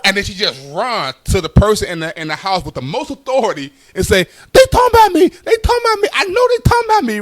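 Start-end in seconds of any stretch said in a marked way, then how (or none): 4.5-7.2 very high, loud wailing complaint; upset aunt. Retold or imitated. Deaf aunt running to the family authority, high wailing complaint.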